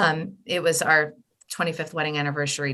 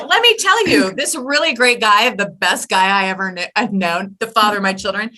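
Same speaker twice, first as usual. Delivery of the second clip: high, loud comic outburst of disbelief, easing down; mock incredulous; 4 semitones higher, 6 dB louder than usual. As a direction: throw the question back as a hammed-up retort. Acted retort to husband's question.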